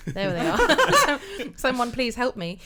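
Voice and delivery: ordinary talk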